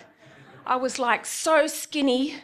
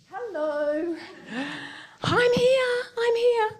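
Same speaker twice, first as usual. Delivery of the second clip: mock indignant; 11 semitones higher, slower than usual. To men staring at her chest, a high mock call. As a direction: wave them up to look at her face.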